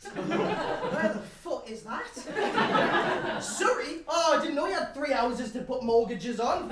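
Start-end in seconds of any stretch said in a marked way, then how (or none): none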